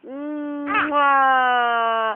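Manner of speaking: kiss sound. Real, not acted.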